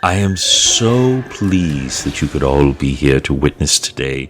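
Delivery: slow, stately ceremonial address. Acted. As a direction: address the assembled Drow slowly, with measured grandeur. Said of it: "and she says".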